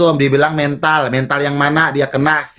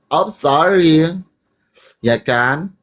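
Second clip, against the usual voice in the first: ordinary talk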